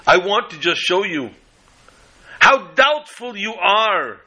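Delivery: attorney lecturing the jury, pitched high and smug; triumphant orator. Acted. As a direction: savour the trap you just sprang. Attorney springs his trick.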